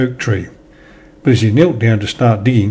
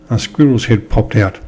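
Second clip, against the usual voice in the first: ordinary delivery, nothing marked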